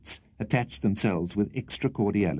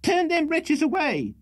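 very high, outraged scolding; shrill tirade; 14 semitones higher than usual. A narrator's reading. Landlady berating her lodger at two in the morning, shrill. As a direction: pour out the grievances shrilly. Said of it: "great shrillness and rapidity of utterance".

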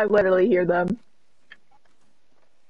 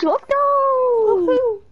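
excited; 13 semitones higher than usual. Real, not acted. Excited game start callout.

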